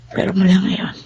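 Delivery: soft hushed whisper. Acted. Mother to recovering baby, whispering.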